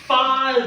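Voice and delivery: "father" said loud, tongue out on th; model father. Shown as a demonstration. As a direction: give "father" a clear th. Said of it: "everybody say".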